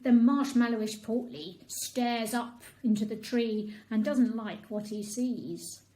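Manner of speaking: ordinary delivery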